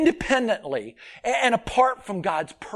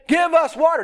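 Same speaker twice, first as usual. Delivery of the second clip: mock demanding; 8 semitones higher, 6 dB louder than usual. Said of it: "they demanded".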